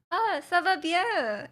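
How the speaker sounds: high, drawn-out cheery reply; exaggerated conversational French; mock small talk. Answers own question in practice dialogue.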